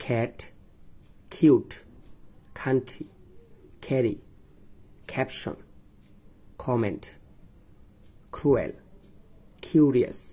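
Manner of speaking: every initial k in Cat, kite, queen breathed out like খ; aspirated k. Shown as a demonstration. Teacher drilling students on aspirated k words. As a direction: puff air on each starting k. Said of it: "কে এর উচ্চারণ হবে খ এর মতো".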